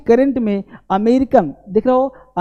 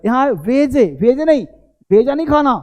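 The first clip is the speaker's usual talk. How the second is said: ordinary talk, nothing marked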